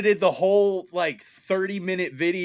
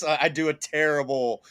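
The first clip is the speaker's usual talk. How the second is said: ordinary talk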